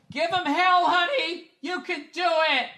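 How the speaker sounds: squeaky, sugary cheerleading housewife parody; mock supportive wife. Husband mocking a wife cheering him off to the coal mine, falsetto mock-sweet.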